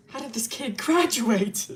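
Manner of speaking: slow question broken by laughter; laughing disbelief. Real, not acted. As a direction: ask it incredulously, cracking up. Breaks off story, laughing at character.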